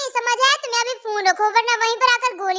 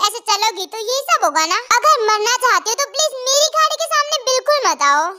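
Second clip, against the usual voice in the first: ordinary talk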